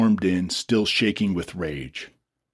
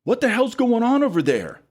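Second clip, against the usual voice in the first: high shouted question; yelling. A narrator's reading. Dylan shouts toward the commotion, yelling. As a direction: shout down the ridge.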